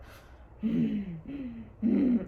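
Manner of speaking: imitated wordless whine through labour pain; closed-mouth groans in short bursts; pained moan. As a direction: act out humming through the pain. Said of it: "like that".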